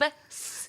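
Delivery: "S" as a long unvoiced hiss; extended hissing S. Shown as a demonstration. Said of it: "not lisp".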